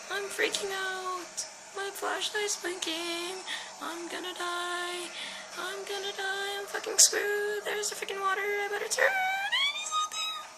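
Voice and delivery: high, soft, near-crying panic; terrified gamer whimpering while being chased; frightened whimper. Real, not acted. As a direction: whimper it, close to tears.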